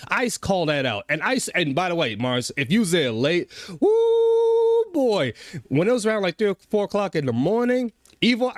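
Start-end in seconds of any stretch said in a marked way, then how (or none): 3.8-5.3 excited whoop. Real, not acted. Hyping up a late-night rant.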